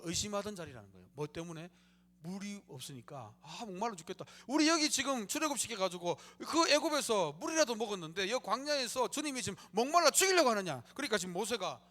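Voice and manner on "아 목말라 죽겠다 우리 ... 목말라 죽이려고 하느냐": angry complaint. Retold or imitated. Israelites complaining of thirst.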